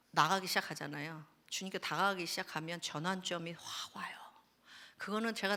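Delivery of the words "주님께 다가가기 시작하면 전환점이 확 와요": hushed whisper of the turning point. Real, not acted. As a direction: lean in and whisper it. (속삭이듯 전하는 전환점)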